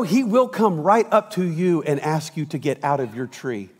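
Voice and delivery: ordinary delivery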